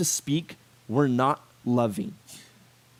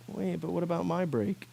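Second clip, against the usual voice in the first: much softer, let-down question; deflated; 2 semitones higher, 8 dB quieter than usual. Retold or imitated. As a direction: trail off, feeling ignored. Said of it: "And you're like".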